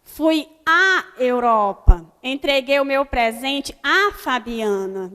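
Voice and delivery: "à" held long before "Europa" and "Fabiana"; doubled A. Shown as a demonstration. Teacher giving crase examples to pupils, stretched "à". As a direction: punch and stretch each "à" like two A's. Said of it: "dá a entender que eu estou falando dois A".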